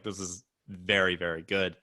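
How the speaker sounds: a hint of a grave connoisseur; mock solemn. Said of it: "I was like".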